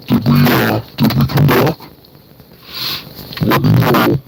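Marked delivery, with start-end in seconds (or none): 0.1-1.8 distorted mechanical voice. Real, not acted. Host hearing her own glitching mic: low mechanical robot voice. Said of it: "I sound like Darth Vader".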